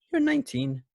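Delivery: squeaky high mock mother; high-pitched mom voice. Greg's mother asking Tommy's age, in a high squeaky voice. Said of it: "where he's just like".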